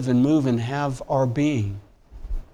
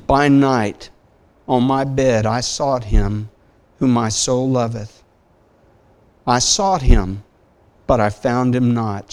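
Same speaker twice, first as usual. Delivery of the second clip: loud, ringing recital of the verse; impassioned scripture reading; 5 dB louder than usual. Acted. Reads Song of Solomon aloud.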